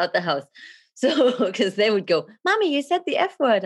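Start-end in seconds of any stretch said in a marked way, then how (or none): 2.4-3.6 high mock child voice; child's telling-off. Kids scolding mommy for swearing, high imitated child voice.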